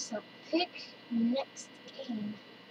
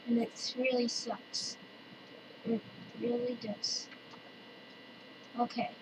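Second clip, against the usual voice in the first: usual speaking voice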